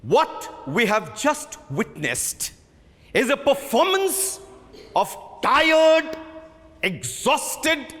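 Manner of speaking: rising to high angry shouts; scathing rebuke. Acted. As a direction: tear into the cast, building. Director berates the rehearsal.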